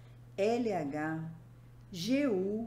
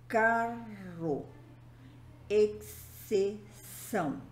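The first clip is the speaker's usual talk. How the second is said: "carro" and "exceção" pulled apart by syllable; syllables separated; much slower than usual. Shown as a demonstration. Teacher showing digraphs that must be separated, syllable by syllable. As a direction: sound out "carro" and "exceção" in pieces. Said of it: "devem ser separadas".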